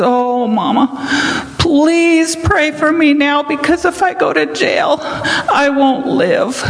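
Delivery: high, slow, tearful pleading; crying. Retold or imitated. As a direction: beg mama through tears, voice up high. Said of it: "he just started crying".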